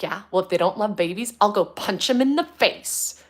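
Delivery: high, loud little-girl threat, imitating her; toddler's fierce threat. Mother imitating her toddler daughter's tough threat, loud and high. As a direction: imitate a tiny girl swearing to punch someone. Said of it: "She's like".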